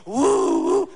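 high, loud drawn-out moan; mock bedroom moan. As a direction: let out a big pleasure moan. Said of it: "As soon as you go".

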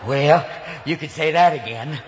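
low, genial presidential reply; Ronald Reagan. Acted. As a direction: answer as the president, voice dropped low. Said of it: "Excuse me, Mister Reagan".

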